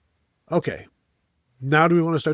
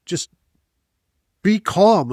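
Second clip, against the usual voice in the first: exasperated plea; 4 semitones higher, 4 dB louder, slower than usual. Retold or imitated. Exasperated plea at own team.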